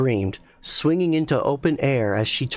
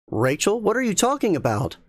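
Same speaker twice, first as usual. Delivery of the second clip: raised, fast puzzled call from the hallway; calling back; 9 semitones higher than usual. Acted. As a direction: call out, confused. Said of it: "Mark called back".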